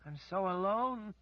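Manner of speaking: high, loud wail of despair; a wail from someone trapped in grief. A narrator's reading. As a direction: wail it out, lost and alone. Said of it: "she wailed".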